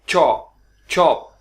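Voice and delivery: चॉप said slowly with a crisp ch and stopped p; slow drilled ch. Shown as a demonstration. Teacher drilling चॉप slowly for students. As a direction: clip चॉप off at the p. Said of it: "अगर आप रोक लें यहाँ पे".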